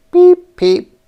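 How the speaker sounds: very high, loud peeping; peeping frogs; frog peeps. Acted. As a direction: peep like a tiny frog.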